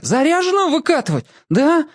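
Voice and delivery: put-on high scared whining kid; whiny little sibling. A narrator's reading. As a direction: fret about it in a high voice. Yashka keeps protesting, frightened.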